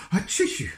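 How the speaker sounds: high, loud acted sneeze. A narrator's reading. Mister Sneeze in the cold town, sneezing high and loud. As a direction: blast out a big achoo. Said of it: "replied Mister Sneeze".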